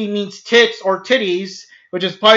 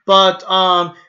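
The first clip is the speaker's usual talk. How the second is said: ordinary delivery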